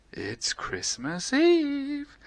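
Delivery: very high, slow, drawn out; sing-song announcement. A narrator's reading. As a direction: stretch it out with wonder. Santa announces.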